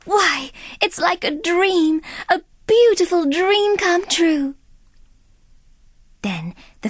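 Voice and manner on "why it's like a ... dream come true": much higher, slow, wondering delight; higher, breathless dreamy wonder; enchanted Cinderella. Acted. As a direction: gasp in awe at the slippers.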